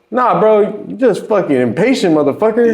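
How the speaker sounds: high mock scolding; a hammed-up high scold; mock retort. Rapper scolding the impatient engineer, high and mocking.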